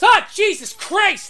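high, loud frightened yelp; startled yelp. Acted. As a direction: jump out of your skin and shriek. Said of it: "he goes".

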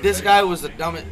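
ordinary talk, nothing marked

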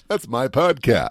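lightly put-on voiceover delivery. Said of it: "I don't do it in my voiceover voice".

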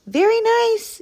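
very high-pitched yelling rabbit; high, emphatic rabbit yell; rabbit's yell. A narrator's reading. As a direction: yell it high, for someone who can't hear. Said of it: "yelled Rabbit".

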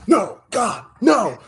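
high, loud, drawn-out no; anguished protest. Real, not acted. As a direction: wail no in mock despair. Protesting the pick in mock despair.

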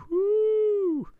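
long, high, loud "whoo"; excited whoop. Real, not acted. Cohost hyping up Stitch waffles, high loud whoop. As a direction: let out a piercing sustained whoop.

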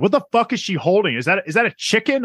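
high-pitched baffled disbelief; retold freak-out. Viewer retelling confusion at a dark screen, freaking out. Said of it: "I'm, like, freaking out".

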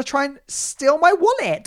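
high, drawn-out exasperated squeal; verging on scream. Real, not acted. Storyteller fearing a stolen wallet, high squeal nearing a scream. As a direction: let it climb slowly towards a scream. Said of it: "Don't make me scream right now".

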